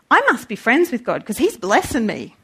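normal talking voice

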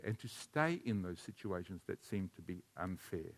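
quiet pulpit reflection; hushed, earnest preaching. Real, not acted. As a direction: bring it right down, sincere and low. Preacher drops to earnest confession.

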